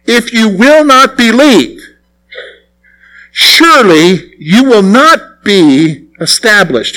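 slow, weighted reading of "If you will not believe"; emphatic re-reading. Shown as a demonstration. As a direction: spell out "surely you will not be established" slowly, word by word. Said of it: "look at that last part".

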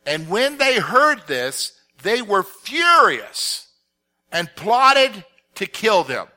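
slow, emphatic Bible narration; dramatic public scripture reading. Acted. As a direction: read it out slowly, leaning on key words. Reads the Bible passage aloud.

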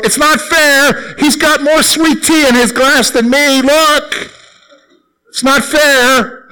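put-on high, slow whining kid voice; whiny child. Boy complaining to his mom at dinner, high whine. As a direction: whine it like a little boy at the table.

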